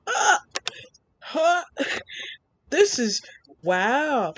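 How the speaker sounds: squealing ah, very high, then settling down; excited squeal. Retold or imitated. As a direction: shriek with delight and trail off. Lesbians kiss on She-Ra.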